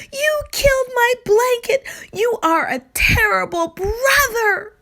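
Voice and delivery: high, loud furious yell; little sister yelling at her brother; loudest yell. A narrator's reading. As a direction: yell it with everything you've got. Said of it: "yelled the loudest yell possible".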